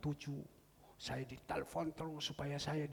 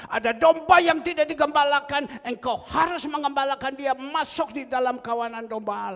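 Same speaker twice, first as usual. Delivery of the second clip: higher, louder sermon cadence; fiery preaching; 11 semitones higher, 15 dB louder than usual. Acted. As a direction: rouse the congregation with certainty. Quoting scripture at full force. (berkhotbah dengan semangat dan suara lantang)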